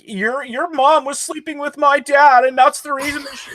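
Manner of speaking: high, mock tearful whine; whining like a baby. Friend mocks the killer's excuse about his dad, babyish whine. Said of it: "he's whining like a baby".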